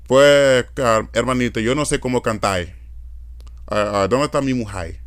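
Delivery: Cibaeño Spanish, final r turned to i; Cibao Dominican accent. Shown as a demonstration. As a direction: demonstrate a northern Dominican accent. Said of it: "It's called the Cibaiano".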